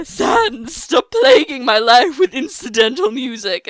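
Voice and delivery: exasperated, theatrical cartoon outburst; Papyrus. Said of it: "that's the voice I've given him".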